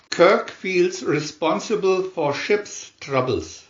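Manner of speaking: ordinary delivery, nothing marked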